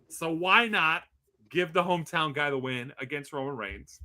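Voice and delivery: usual speaking voice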